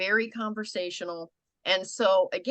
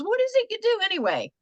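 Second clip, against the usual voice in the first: bright, much higher, quick curious ask; curious question; 13 semitones higher, faster than usual. Retold or imitated. Coach voices a shopper, high and curious. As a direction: play the chatty shopper asking. Customer asks casual question.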